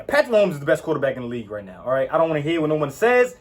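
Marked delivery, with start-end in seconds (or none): 0.0-1.8 fast, run-together statement; quick throwaway version of the claim; rushed claim. Real, not acted. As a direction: rattle it off quickly. Restating his top claim.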